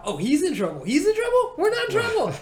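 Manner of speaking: put-on rising high amazed kid voice; a child realizing he's off the hook; high delighted disbelief; gleeful surprise. Kid realizing mom scolds guard.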